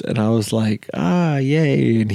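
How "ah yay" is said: high-pitched mock cheer; retold half-hearted yay; feigned enthusiasm. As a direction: pretend to be pleased for politeness. Reluctant reply to unwanted new friend.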